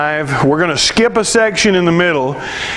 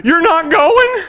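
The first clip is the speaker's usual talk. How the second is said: disbelief; 10 semitones higher, slower than usual. Retold or imitated. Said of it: "And I'm like".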